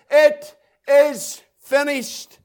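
slow, weighty declaration; proclaiming cry. Acted. Preacher voicing the voice that breaks into despair, slow cry. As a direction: draw out each word as a proclamation. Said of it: "suddenly a voice comes and cries".

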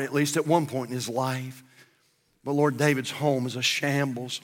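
earnest prayer building over music; earnest closing prayer, swelling at the petitions; pulpit prayer. Acted. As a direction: pray for the church. Pastor leads closing prayer.

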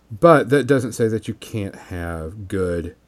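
normal talking voice

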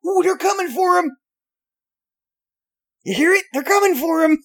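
high, loud gleeful gossip; thrilled someone's getting caught; breathless delight, laughing; excited. Real, not acted. Excited about police outside.

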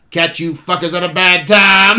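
loud impression of an action-film line. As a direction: belt it out like the character crashing in. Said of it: "It's just the way it's delivered by [name]".

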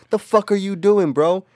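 high, loud mock outrage; retold scolding. Narrator scolds wandering drunk friend, mock outrage.

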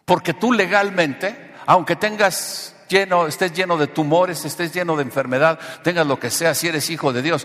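high, loud, emphatic sermon; full-force preaching. Real, not acted. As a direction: hammer it out to the congregation, stretching key words.

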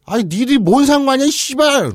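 furious high yelling father; angry shout. Acted. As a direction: scream it at the intruders. 아버지 역 외침. (화난 아버지의 고함)

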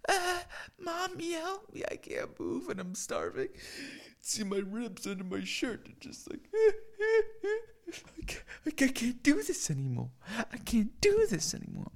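very high, soft, whimpering plea to Mom; mock sobbing falsetto; hammed-up crying plea. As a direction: whine like a starving child begging. Jesus crying to his mother.

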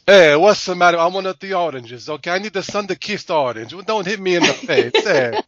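high, loud comic Italian complaining; hammed-up pleading, pitched well up; mock Italian protester. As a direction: play up an indignant Italian in the orange fight. Joking as Italian orange-fight townsperson.

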